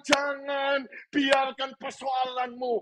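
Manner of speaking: high, driving sermon voice with rising emphasis; full-force preaching. Real, not acted. Preacher urging congregation to believe in exceptions, full-force preaching.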